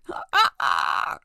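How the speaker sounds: low growling roar; dragon roar. Pretend. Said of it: "Insert dragon noise here".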